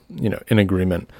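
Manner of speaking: ordinary talk, nothing marked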